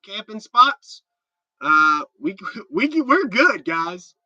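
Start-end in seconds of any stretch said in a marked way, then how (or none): none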